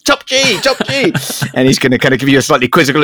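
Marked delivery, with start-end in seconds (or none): 0.1-1.1 high excited mock fan call; mock Cockney fan hailing Tate, much higher; fawning fan greeting. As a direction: gush at him like a starstruck fan. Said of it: "you might say".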